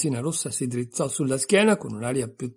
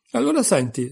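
higher, louder exclamation; exclaiming; 7 semitones higher, 6 dB louder than usual. A narrator's reading. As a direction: call for attention with a raised voice. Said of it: "esclamò la Resina Rossa". (esclamazione a voce alta)

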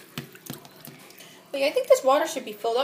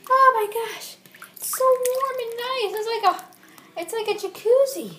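delighted; 7 semitones higher than usual. Pretend. Toy enjoys warm water.

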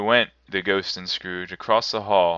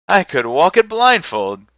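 much higher, loud eager cry; fervent; 10 semitones higher, 9 dB louder than usual. A narrator's reading. Scrooge tells the spirit he knows the way, fervent cry. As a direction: boast with passion. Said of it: "with fervor".

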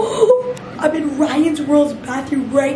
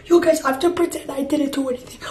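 ordinary talk, nothing marked

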